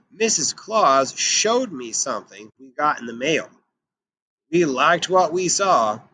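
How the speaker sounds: lively, expressive read-aloud as Santa; storybook narration voice for young listeners; reading to children. Acted. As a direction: tell the story to a class, bringing Santa to life. Teacher reads Santa picture book aloud.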